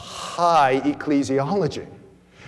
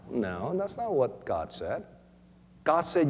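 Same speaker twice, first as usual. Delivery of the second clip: ordinary talk, nothing marked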